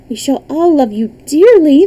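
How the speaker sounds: lightly voiced eager princess; gushing princess. A narrator's reading. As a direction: promise it adoringly. Third princess fawns over the boy.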